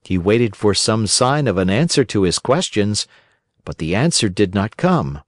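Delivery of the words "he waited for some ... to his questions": ordinary delivery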